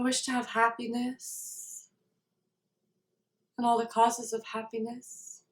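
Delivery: soft meditation voice with long silences; guided meditation. Real, not acted. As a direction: settle into a quiet, spacious recitation of a wish.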